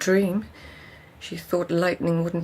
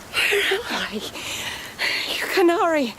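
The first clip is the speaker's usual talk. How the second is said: eerie high call; 12 semitones higher than usual. Acted. Dream voice, far and high.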